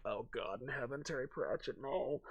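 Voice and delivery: very soft, aghast aside; hushed mock dismay. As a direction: murmur it in mock despair. Acted dread at a Pratchett book.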